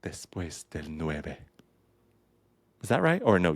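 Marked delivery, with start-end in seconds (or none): 0.0-1.4 Después del Nueve in a slow, quiet, careful Spanish accent; proper Spanish accent. Shown as a demonstration. Dancer showing listeners her proper Spanish accent on the title. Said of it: "I'm gonna do a proper accent".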